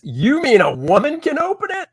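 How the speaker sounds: very high, incredulous ad read; mock astonishment. Reads vintage ad in mock astonishment.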